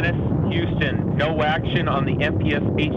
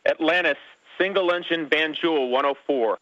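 tinny, distant radio transmission; space radio; 6 semitones higher than usual. Real, not acted. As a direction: call it over a crackly radio link. Mission control over the radio loop.

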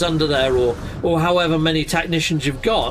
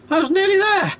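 excited; 13 semitones higher than usual. Real, not acted. Chasing the runaway little guy.

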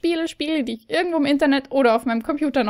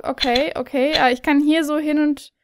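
ordinary talk, nothing marked

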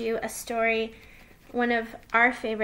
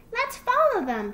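high, loud little fox; childlike burst of eagerness; excited cry; 12 semitones higher, 6 dB louder than usual. A narrator's reading. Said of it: "he cried".

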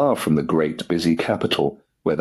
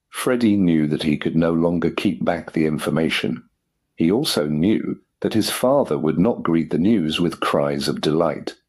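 plain reading voice

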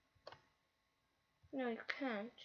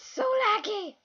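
high, loud, drawn-out complaint; loud, whiny gamer exasperation; exasperated outburst; 9 semitones higher, 9 dB louder, much slower than usual. Real, not acted. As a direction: wail it in frustration, stretching the words.

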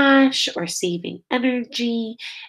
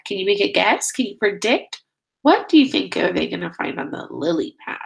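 usual speaking voice

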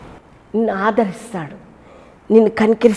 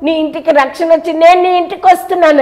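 louder and higher, calling up to someone; calling up to a tree; 8 semitones higher, 5 dB louder than usual. Retold or imitated. Jesus calling Zacchaeus down from the tree, loud and high. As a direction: call him down from the branches. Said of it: "అన్నాడు".

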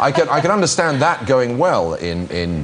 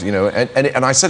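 ordinary delivery

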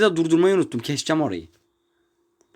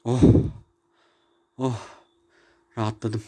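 relieved sigh; 8 semitones lower than usual. Real, not acted. Relief after swearing.

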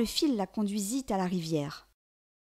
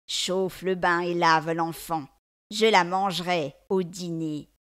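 slow, louder witch command; sinister witch; 3 semitones lower, 4 dB louder, slower than usual. A narrator's reading. Witch to her servant in the yard, slow sinister order.

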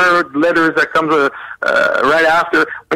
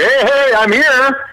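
high, cheery party-crasher call; barging into a party; 9 semitones higher, slower than usual. Acted. Guest bursting in on a party, high and breezy. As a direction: announce yourself brightly, pitched up. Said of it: "get into the party and say".

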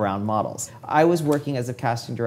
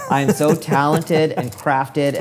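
mock bragging; 2 semitones higher, 3 dB louder, slower than usual. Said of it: "this was my thinking".

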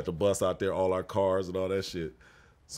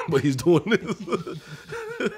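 breaking into laughter mid-line; laughing at the memory of him bopping; 10 semitones higher, 7 dB louder, much slower than usual. Real, not acted. Laughing while describing teammate bopping.

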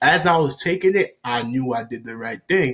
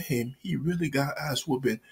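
hushed guilty musing; 10 dB quieter than usual. Retold or imitated. Guilty thought alone in room.

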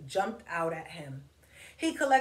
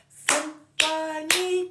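Symphony high, loud and slow, syllable by syllable; loud, stretched chant of Symphony; clapped syllables; 8 semitones higher, 7 dB louder, much slower than usual. Shown as a demonstration. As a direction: beat out each syllable of Symphony. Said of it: "Clap it".

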